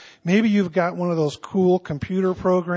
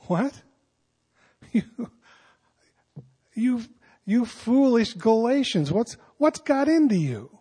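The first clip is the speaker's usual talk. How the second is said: puzzled, rising disbelief; baffled rather than angry, higher; gently incredulous Paul, not scolding; bemused; 5 semitones higher than usual. Shown as a demonstration. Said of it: "the word that comes to mind is bemused".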